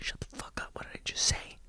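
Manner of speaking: angry parent's whisper; hushed, harsh reprimand; scolding whisper. Acted. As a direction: tell the kid off without a sound. Parent scolding child while hiding.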